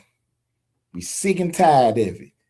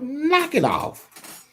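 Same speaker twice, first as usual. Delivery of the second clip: scolding dismissal; 10 semitones higher, much slower than usual. Real, not acted. Scolding critics of the speaker.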